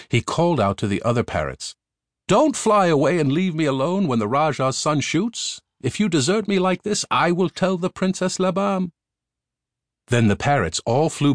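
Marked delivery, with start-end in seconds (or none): 2.3-8.9 high, pleading bird voice pitched well up; a parrot king calling his flock back, high; parrot king's voice. Acted. Parrot king calls his fleeing flock back, high pleading voice.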